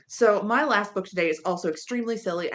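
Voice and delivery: ordinary delivery, nothing marked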